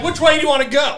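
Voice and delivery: high, loud imitation of a fed-up father; mock exasperated dad. Son imitating dad at the wheel, exasperated. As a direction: demand it, voice jumping up.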